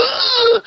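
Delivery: very high mock wail; hammed-up, high-pitched bawl; imitated bawling. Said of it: "just starts bawling".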